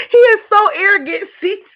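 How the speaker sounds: high, loud, through laughter; cracking up while she says it; laughing. Real, not acted. Laughing at him to her viewers, loud and high. Laughing fit over the streamer.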